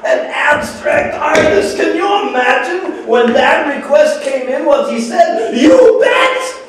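very high, loud, incredulous glee; uproarious, high-pitched crowing; gleeful climax. Acted. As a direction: shout it, soaring to the punchline.

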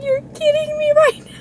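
can't believe it; delighted disbelief. Real, not acted. Owner overwhelmed by the kittens, squealing.

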